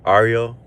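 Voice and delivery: Ario opening on AR, "AR-ee-oh"; third pronunciation. Shown as a demonstration. Streamer settling on a chat member's name, Ario said "AR-ee-oh". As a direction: say Ario loudly as "AR-ee-oh". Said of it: "not sure if you say".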